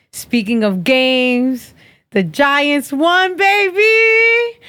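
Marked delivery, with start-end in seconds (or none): 2.1-4.6 excited cheer. Real, not acted. Celebrating team win, calling out.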